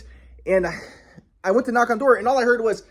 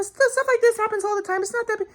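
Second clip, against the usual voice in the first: much higher, sneering parody, fading off; mocking evil Sylvie; 13 semitones higher than usual. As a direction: mimic her callous shrug of a line. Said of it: "the way she said it was so fucking evil".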